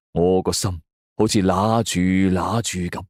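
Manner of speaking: ordinary delivery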